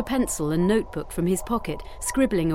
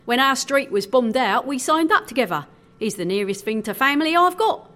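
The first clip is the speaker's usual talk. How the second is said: higher, lively sailor's voice; upbeat; 6 semitones higher, 4 dB louder than usual. A narrator's reading. Sailor telling of his bombed street, upbeat. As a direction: keep it cheerful over a sad past. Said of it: "upbeat nature of his tone".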